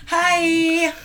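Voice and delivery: high, loud cheery hi; high bright hello. Real, not acted. Guest greets listeners.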